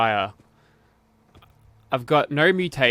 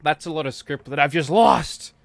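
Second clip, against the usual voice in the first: mock outrage; 3 semitones higher, 6 dB louder than usual. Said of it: "they'll be like".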